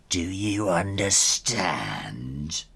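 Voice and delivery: low, drawn-out threat; slow menacing question. Acted. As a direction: lean in and menace. Tiddles threatens Ginger.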